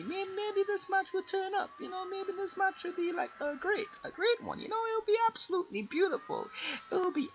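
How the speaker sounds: high, lightly mocking hopeful voice; sing-song optimism. Gamer hoping the next match goes well, high and hopeful.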